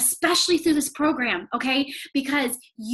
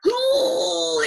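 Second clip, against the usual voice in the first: a high, far-off, crying "Ooh"; 12 semitones higher, 3 dB louder than usual. Retold or imitated. Remembering her late professor, a high tearful cry. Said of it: "it's like".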